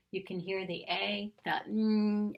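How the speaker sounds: ordinary talk, nothing marked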